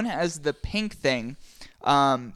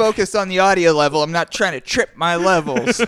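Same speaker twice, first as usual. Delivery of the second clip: loud mock defensive; 3 semitones higher, 8 dB louder than usual. Mock-defensive about audio levels.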